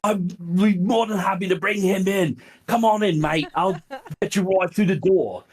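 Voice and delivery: hammed-up British accent, pitched well up; heavy British accent. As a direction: put on a big, eager British "cheers, mate" voice. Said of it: "in his heavy British accent".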